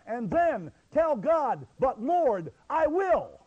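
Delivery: high, loud preaching, hammering each phrase; fervent exhortation. Real, not acted. Preacher urging dads to pray before God, loud and fervent. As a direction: drive each command home forcefully.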